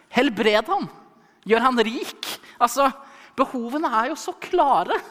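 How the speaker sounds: building from incredulous to loud, high exasperation; exasperated protest. Acted. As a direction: argue with Jesus as if it's blindingly obvious. Acted exasperated plea to Jesus. (oppgitt, stadig høyere protest)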